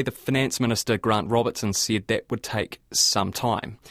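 ordinary talk, nothing marked

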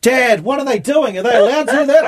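put-on high and loud, a kid calling to dad in disbelief; child's outraged question. Recalling asking dad at nine, high loud disbelief. Young self asks dad.

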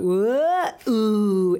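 rising, drawn-out crowd oohs; crowd reaction. Retold or imitated. Fan retelling every ball of the over, imitated crowd oohs. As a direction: imitate a whole stand gasping at the ball. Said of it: "everyone's like".

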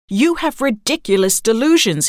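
narrated angry outburst; exasperated shout. A narrator's reading. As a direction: shout it at your wife, fed up. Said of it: "he shouted".

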